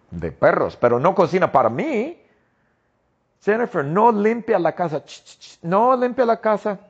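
high, whiny list of complaints; complaining husband. Acted. Husband complaining about his wife, high and whiny. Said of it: "yo no vivo con Jennifer".

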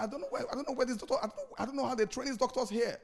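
mock-indignant relative complaining; mimicking a complaining relative. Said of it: "He said, he said".

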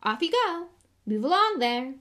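loud, high call to a crowd; breezy commanding shout. Acted. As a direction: shoo everyone along heartily. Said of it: "Lockhart called to the crowd".

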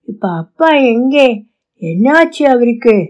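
ordinary delivery

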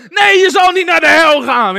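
very high, very loud mock protest; hammed-up high shout; shouted contradiction. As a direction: imitate a lad yelling desperate reassurance. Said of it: "die andere dacht dat die vrouw het zei".

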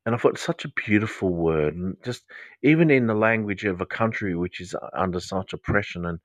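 ordinary delivery

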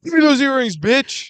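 high, loud thug demand; mock yell. The investigator yelling at the thief for the earrings. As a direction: bark the demand like a hammed-up thug. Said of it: "investigator demanding earrings".

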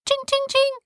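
very high ringing "ching"; imitated glass clinks. A narrator's reading. Narrator imitates paper hitting rum glasses, high clinking. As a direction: mimic glasses pinging in a high pitch.

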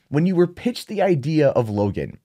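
normal talking voice

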